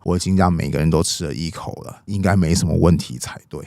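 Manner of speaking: low, subdued lordly reply; 曹操, unruffled. Acted. Cao Cao answers his alarmed adviser, low and unruffled.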